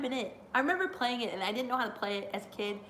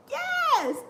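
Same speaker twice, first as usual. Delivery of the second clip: high, louder yes; retold cheer; 5 dB louder than usual. Cheering Becky raising the title, a high excited yes. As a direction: cheer it like the crowd. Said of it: "I was like".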